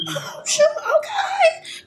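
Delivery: squealing with laughter at finally being understood; laughing relief. Real, not acted. Relief that someone speaks English.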